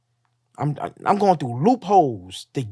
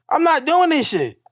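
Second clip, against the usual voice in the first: high-pitched indignant refusal; retold defiant refusal; 11 semitones higher, 3 dB louder than usual. Patient told to hand over shoelaces, indignant refusal. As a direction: act out digging your heels in, pitched way up.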